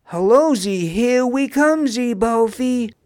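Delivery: high, loud gleeful chant; gleeful singsong. A narrator's reading. Pun celebrates heading for land, gleefully singing out. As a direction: sing it out with glee. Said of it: "gleefully sang Pun".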